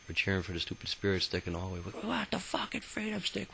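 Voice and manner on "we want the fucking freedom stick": pitched-way-up pretend shouting; mock yelling. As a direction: imitate a rowdy chant in a high squeezed voice. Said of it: "We're yelling".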